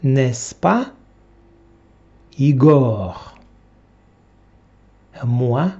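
ordinary delivery

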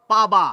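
পাবা said loud, with a clear b sound, the village way; rural Bengali word. Shown as a demonstration. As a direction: say পাবা as village folk do, with a real b. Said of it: "তারা কিছু কিছু শব্দ হয়তো শুনে থাকবেন".

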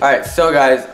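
ordinary talk

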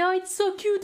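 imite un «trop mignon» aigu; attendri et moqueur. Retold or imitated. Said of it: "il me disait". (imitation attendrie, voix haut perchée)

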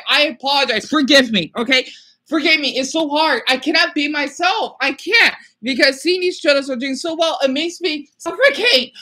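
high, loud, strained plea for forgiveness; distressed pleading. Real, not acted. Distressed apology to viewers.

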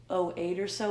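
usual speaking voice

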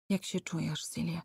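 whispered, holding his breath; breathless whisper. A narrator's reading. Tengel quietly asks Celia after the attack, whispered. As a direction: ask gently, barely a breath. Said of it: "wstrzymując oddech".

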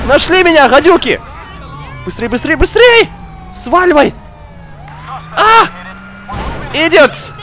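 ordinary delivery

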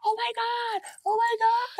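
very high-pitched mock squeal; excited fan girls.

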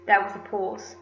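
ordinary delivery

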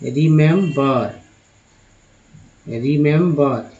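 रिमेंबर said slowly, each syllable clear; slow model word रिमेंबर; careful pronunciation. Shown as a demonstration. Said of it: "ये रिमेंबर".

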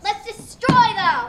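slow, loud battle cry; loud slow shout. Acted. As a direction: bellow it out slowly. Settler calls for attack.

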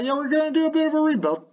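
high, fast, breezy mock announcement; airy high-pitched imitation of the team; mock team statement. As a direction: toss it off like a front office brushing it aside. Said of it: "they said".

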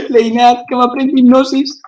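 giggling brag to a friend; laughing boast. Real, not acted. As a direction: gloat while laughing.

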